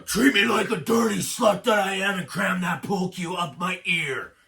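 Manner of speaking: loud, hammed-up dirty talk; mock dirty talk. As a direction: ham up the filthy begging, pushing it loud. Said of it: "a little dirty talk".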